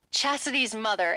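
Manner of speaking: plain reading voice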